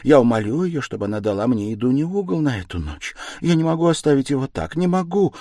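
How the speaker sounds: high, loud, pleading insistence; insistent. A narrator's reading. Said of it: "настаивала Пульхерия Александровна".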